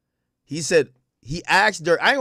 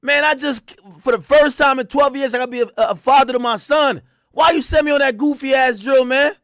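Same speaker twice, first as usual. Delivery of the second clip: mock loud complaint; 8 semitones higher, 5 dB louder than usual. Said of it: "be like".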